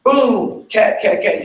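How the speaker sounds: vocalography cue. Shown as a demonstration. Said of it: "The moment you hear boom".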